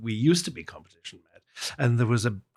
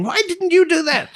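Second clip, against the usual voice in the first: much higher, louder mock-shouted question; mock yell; 8 dB louder than usual. Piano teacher yelling at a young pupil, loud mock shout.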